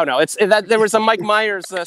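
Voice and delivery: normal talking voice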